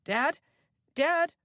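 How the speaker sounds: high, loud anxious calls; alarmed calling. A narrator's reading.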